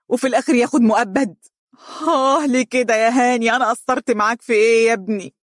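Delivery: anguished lament. Acted. Father lamenting his son's sentence, anguished rising wail. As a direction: pour out the bitter regret, building to a cry. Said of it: "بحسرة".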